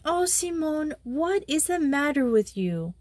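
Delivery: put-on high, anxious young girl voice; worried schoolgirl. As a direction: coax her gently, a worried girl. Joey comforting crying friend.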